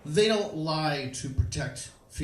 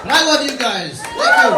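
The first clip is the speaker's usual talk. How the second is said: high, loud shout to the crowd; shouted sign-off; 10 semitones higher, 15 dB louder than usual. Real, not acted. Comic thanks the crowd at set's end, high shout. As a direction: shout it out to the whole room.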